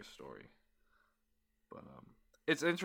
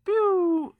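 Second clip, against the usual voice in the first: laser gun noise; 13 dB louder than usual. Pretend. Laser noise sound effect.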